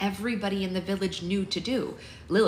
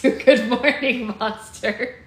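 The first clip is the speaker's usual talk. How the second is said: laughing; 6 semitones higher, 10 dB louder, much slower than usual. Real, not acted. Greeting someone waking up.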